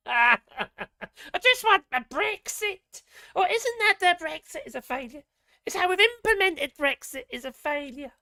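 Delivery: Anne Widdecombe. Retold or imitated. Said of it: "Anne Widdecombe is on G B News".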